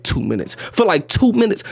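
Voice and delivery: usual speaking voice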